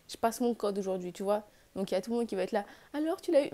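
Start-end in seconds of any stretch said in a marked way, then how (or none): 2.9-3.5 nosy neighbour. Retold or imitated. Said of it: "Même mes voisins, ils m'attendent là".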